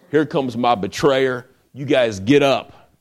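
acted-out order to get moving; urgent rousing command. Jesus rouses disciples as betrayer comes.

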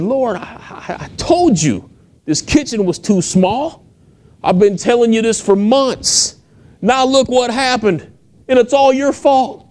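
high, pleading, near-tearful complaint; frustrated complaint to God. Retold or imitated. Woman in the kitchen blaming the Lord, high exasperated wail.

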